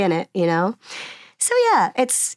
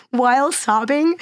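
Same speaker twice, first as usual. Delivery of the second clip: ordinary delivery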